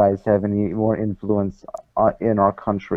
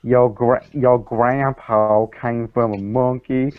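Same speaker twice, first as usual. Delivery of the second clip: slow Southern Bible-thumper drawl; Southern accent; slower than usual. Acted. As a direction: preach it like a hammed-up Southern creationist. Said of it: "You should work on your accent".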